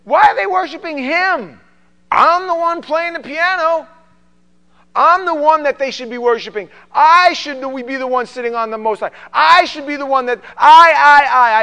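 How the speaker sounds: high, shouted, indignant rant; Lucifer. Acted. Jealous worship leader claims the throne, indignant shouting. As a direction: shout it like a jealous, self-exalting rebel. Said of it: "He said".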